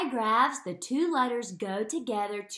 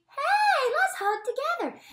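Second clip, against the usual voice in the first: much higher, cheerful cartoon letter voice; letter H talking; 3 dB louder than usual. Teacher voicing letter H to letter C, high and cute. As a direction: play the H as a sweet little character. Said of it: "the H says to the C".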